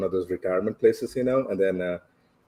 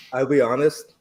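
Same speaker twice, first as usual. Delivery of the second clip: normal talking voice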